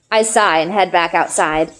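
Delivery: ordinary delivery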